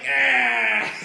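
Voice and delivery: high, loud demon yell; hammed-up monster scream; mock demon cry. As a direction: cry out as a stricken demon, playfully. Acting out demon being hit.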